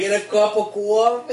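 high mock Boston accent; hammed-up Boston question. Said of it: "the worst Boston accent".

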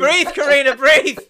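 loud laughing plea; laughing urging. Real, not acted. Telling laughing Karina to breathe, loudly. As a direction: urge her through laughter. Friend laughing too hard.

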